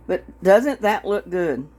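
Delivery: usual speaking voice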